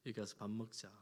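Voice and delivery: softly, tenderly inviting; a tender, hushed invitation; gentle invitation. Acted. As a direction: call them in quietly to eat. Lord's gentle invitation repeated.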